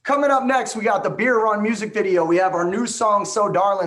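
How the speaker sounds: fast, high, loud auctioneer patter. Retold or imitated. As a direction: rattle it off like a county auctioneer. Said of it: "he talks like a Gundy County auctioneer".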